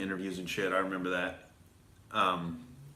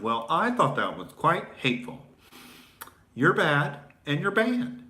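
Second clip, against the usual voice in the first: higher-pitched mocking verdict; put-on voice of an offended censor; mock prissy judge; 6 semitones higher than usual. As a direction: pass judgment in a hammed-up superior voice.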